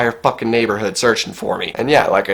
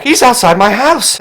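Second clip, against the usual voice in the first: higher, louder alarmed report; urgent alarm; 10 semitones higher, 6 dB louder than usual. Retold or imitated. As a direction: tell the police he's right here, urgently. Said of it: "I'm on the phone with the police and I'm like".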